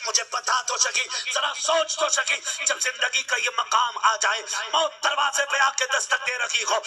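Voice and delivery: impassioned sermon building to loud peaks over music; full-force pulpit preaching about a mother's sacrifice; fiery preaching. Acted. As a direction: preach it hard to the young men. Preacher addresses youth about mothers.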